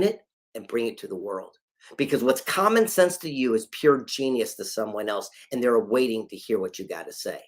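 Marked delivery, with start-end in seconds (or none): none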